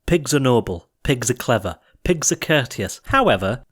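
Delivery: ordinary delivery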